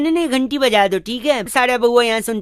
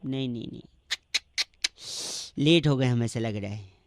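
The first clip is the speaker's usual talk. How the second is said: mock despair; 11 semitones lower, 11 dB quieter than usual. Prankster fakes hopeless despair.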